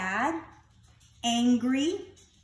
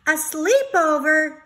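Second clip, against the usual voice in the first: excited cry; 7 semitones higher, 9 dB louder than usual. Acted. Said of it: "Snail cried".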